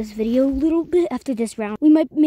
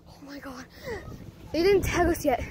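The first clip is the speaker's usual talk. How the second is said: ordinary talk, nothing marked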